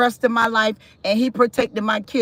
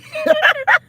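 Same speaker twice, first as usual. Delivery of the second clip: sudden high-pitched burst of laughter; a real squeal of a laugh. Real, not acted. Mother cracking up at the memory, high laugh.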